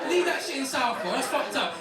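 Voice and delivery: normal talking voice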